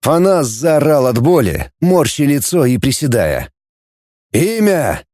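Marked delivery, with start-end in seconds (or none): 4.3-5.0 raised-pitch interrogator's bark; curt order, not shouted; barked demand. A narrator's reading. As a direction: fire it at the prisoner. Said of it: "рявкнул Середа".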